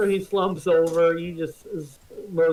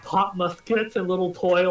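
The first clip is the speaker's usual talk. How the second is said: usual speaking voice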